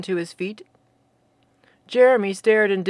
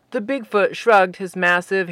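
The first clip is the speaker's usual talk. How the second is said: plain reading voice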